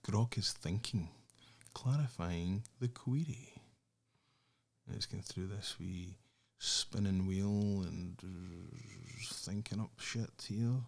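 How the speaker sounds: soft, low running commentary; muttering. Real, not acted. Waiting on Grok to answer, softly muttering. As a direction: murmur to yourself while waiting.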